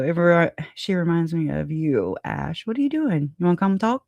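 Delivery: usual speaking voice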